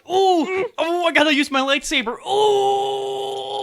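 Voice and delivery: pained high groans while moving; burned man groaning. Pretend. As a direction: groan through every movement. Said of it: "every movement he makes should be like".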